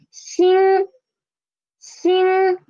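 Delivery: xin high, level and sustained; first tone. Shown as a demonstration. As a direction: hold xin steady up high. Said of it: "the tone is the first tone".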